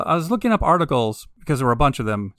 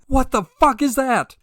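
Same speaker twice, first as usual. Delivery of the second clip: alarmed exclamation; 10 semitones higher than usual. Retold or imitated. Repeats the imagined reaction.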